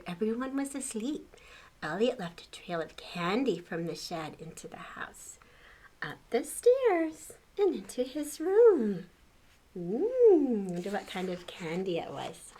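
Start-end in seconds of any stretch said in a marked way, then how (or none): none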